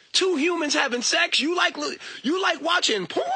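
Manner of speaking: high-pitched scandalized questioning; mock outrage. Accusing someone of liking porn, mock outrage. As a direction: act appalled, pitch jumping up. Acts out people shaming porn watchers.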